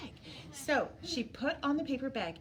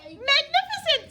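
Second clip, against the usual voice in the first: much higher and louder flattery; magnificent; 10 dB louder than usual. A narrator's reading. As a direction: praise him to the skies, loud and high. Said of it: "said Elizabeth".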